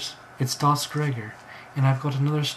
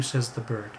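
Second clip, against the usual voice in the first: ordinary delivery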